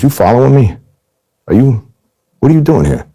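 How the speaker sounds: high, sharp retold challenge from the mobster; suspicious confrontation. Mobster confronting him at the game: imitated high suspicious challenge. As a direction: demand an answer, voice pushed up high.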